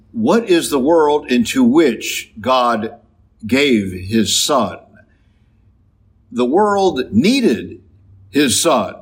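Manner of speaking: normal talking voice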